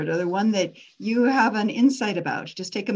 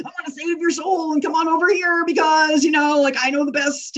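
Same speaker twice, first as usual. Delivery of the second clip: high, loud, hammed-up preacher; horrible preacher version; 7 semitones higher, 5 dB louder than usual. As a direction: overblown pulpit caricature. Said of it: "that's a horrible version of a preacher".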